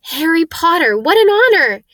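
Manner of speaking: high, loud delighted exclamation; awed excitement. Acted. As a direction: exclaim it like greeting a hero. Bartender thrilled to meet Harry.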